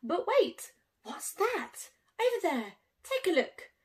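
excited discovery. A narrator's reading. Excited discovery in read story.

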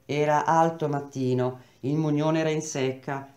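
plain reading voice

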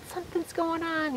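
high, hushed retold alert; hushed alarm. Camper to tent mate as something prowls outside, hushed alarm. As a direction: act out a quiet, high-pitched warning in the tent.

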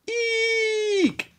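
very high squeaky shriek; high squeaked eek; girls' eek. A narrator's reading. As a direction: squeal in fright like a little girl. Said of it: "yelled all the girls".

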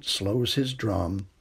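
ordinary delivery

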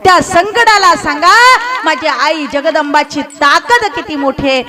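high, ringing exhortation to the congregation; fervent preaching. Acted. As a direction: urge them on with stretched, emphatic key words. Exhorts congregation at full force.

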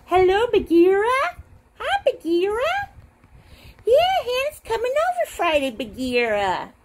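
high, bright-rising phone greeting; pretend phone call.

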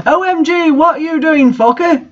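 high-pitched hammed-up angry yell; mock angry shout. A narrator's reading. Professor catching a student in his office, mock angry shout. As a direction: bark it at the intruding student in mock fury. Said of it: "he shouted angrily".

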